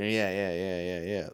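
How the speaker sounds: usual speaking voice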